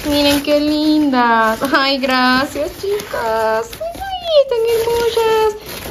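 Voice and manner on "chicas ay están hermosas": high delighted gush. Real, not acted. Thanking friends for gift.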